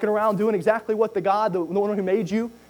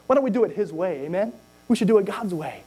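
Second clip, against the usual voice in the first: normal talking voice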